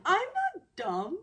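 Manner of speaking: acted-out indignation, high and loud; indignant protest. Woman answering an insult, indignant. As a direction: push back in a high, offended retort.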